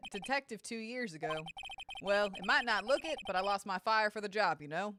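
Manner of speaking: folksy cowboy character, confiding regretfully; cowboy security officer. Acted. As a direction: play a world-weary cowboy lawman.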